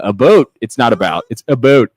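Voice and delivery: reading a comment in a Canadian accent. Shown as a demonstration. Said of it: "I'll read Kellen's in a Canadian accent".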